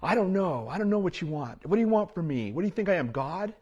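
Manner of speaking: acted exasperation, pitched high throughout; frustrated husband.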